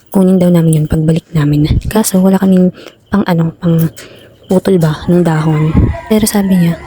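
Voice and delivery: usual speaking voice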